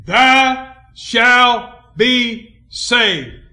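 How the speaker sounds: "Thou shalt be saved" very slow, each word separate; loud, high, drawn-out proclamation of each word; word-by-word emphasis. Real, not acted. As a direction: hammer "Thou shalt be saved" loudly, pausing between words. Hammers the verse word by word.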